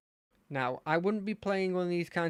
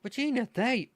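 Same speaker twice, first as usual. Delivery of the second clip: higher-pitched friendly lad; nice-ish character voice; 7 semitones higher, 3 dB louder than usual. Acted. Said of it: "give him a nice-ish voice".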